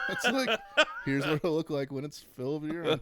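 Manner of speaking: high, loud words laughed out; mocking the ad while laughing hard. Real, not acted. Mocking the product photo.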